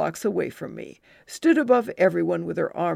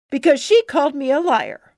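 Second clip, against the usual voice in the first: put-on defiant kid; 7 semitones higher, 9 dB louder than usual. Child defends herself.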